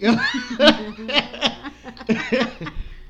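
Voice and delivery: loud, high burst of laughter; laughing. Real, not acted. Host after a crude podcast sign-off, cracking up. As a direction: crack up loudly at your own joke.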